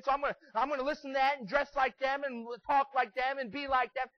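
loud, high, driving sermon delivery; full-force preaching. Real, not acted. Preacher warns teens to choose friends carefully, full-force preaching. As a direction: preach an urgent warning.

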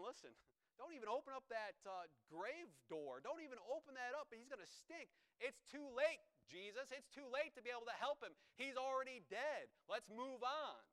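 high, emphatic imitation of naysayers; urgent protest. Disciples urging Jesus to leave the grave shut, mock urgent protest. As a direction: voice the doubters pleading to give up.

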